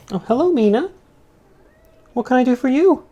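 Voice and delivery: high, cutesy pet-talk voice; talking to a cat. Real, not acted.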